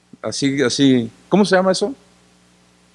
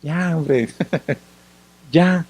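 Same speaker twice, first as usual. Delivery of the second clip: soft, hushed and soothing; calm the Father down gently; soothing Holy Spirit; 3 dB quieter, much slower than usual. Retold or imitated. Holy Spirit softly hushing the Father, soothing. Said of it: "el Espíritu Santo mira al Padre y dice".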